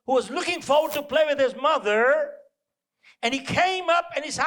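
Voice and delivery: ordinary talk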